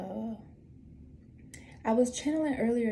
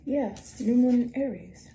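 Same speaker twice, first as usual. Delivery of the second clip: ordinary talk, nothing marked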